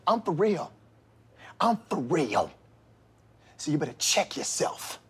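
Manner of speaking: low, hushed menace; quiet menace. Acted. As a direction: drop to a threatening hush.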